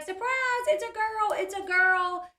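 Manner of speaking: high, loud, sing-song surprise call; excited surprise shout. Retold or imitated. Family greeting the couple at the door with a high excited surprise shout. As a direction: shout the surprise like throwing a party.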